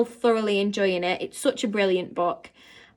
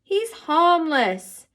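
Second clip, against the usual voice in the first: slow, gentle reassurance; calm her down; reassuring; 7 semitones higher, 5 dB louder, much slower than usual. A narrator's reading. Boy soothing the frightened girl, reassuring.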